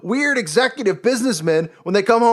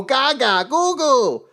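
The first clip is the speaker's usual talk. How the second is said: mock baby talk, a touch higher; lightly voiced goo-goo baby noises; baby babble; 4 semitones higher, slower than usual. Mocking adult babies with lightly voiced baby babble. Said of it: "They go".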